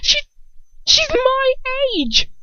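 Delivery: high, loud, sputtering; outburst. A narrator's reading. Draco bursting out at his father, outraged. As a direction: burst out in disbelief. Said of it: "He bursts out suddenly".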